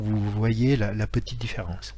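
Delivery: usual speaking voice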